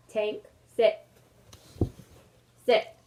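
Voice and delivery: teacher scolding the dog Tank; firm command. Real, not acted. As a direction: tell the dog to sit, sharply.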